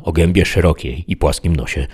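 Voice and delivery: ordinary delivery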